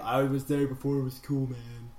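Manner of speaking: lightly voiced laid-back hippie; a low-key hint of hippie. Bragging about knowing it already, lightly voiced hippie. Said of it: "Hippie voice".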